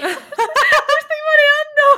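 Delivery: very high, loud squeal through laughter; mareada entre risas. Real, not acted. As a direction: wail it while cracking up, dizzy. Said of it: "ay, me estoy mareando". (chillido mareado, muerta de risa)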